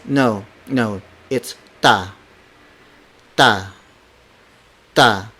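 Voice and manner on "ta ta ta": "ta" with no puff of air after T; unaspirated T. Shown as a demonstration. Teacher models the letter T, "ta" without aspiration. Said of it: "It doesn't have that".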